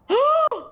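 high startled gasp; sharp gasp. Real, not acted. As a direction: gasp in shock. Sees ghost in photo.

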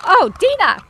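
high, loud, falling cry; exasperated outcry. Real, not acted. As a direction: shout at her in annoyance. Startled, annoyed at companion.

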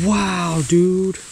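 high, drawn-out wonder; loud, held note of astonishment; amazed. Real, not acted. Angler measuring an almost ten-inch fish, amazed.

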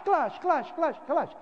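high, quick clanging clash clash imitation; clashing sound imitation. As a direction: mimic the lights clanging together. Imitating lights clashing together.

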